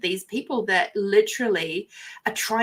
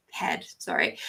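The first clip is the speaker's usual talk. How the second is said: quick whispered correction under the breath; whispered self-correction; whispered aside; 8 dB quieter than usual. Real, not acted. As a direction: drop to a whisper for the slip.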